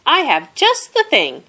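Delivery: chirpy helpful mouse; cheerful mouse voice. A narrator's reading.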